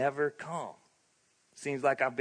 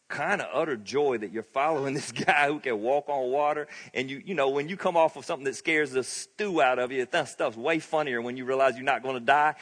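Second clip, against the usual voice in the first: usual speaking voice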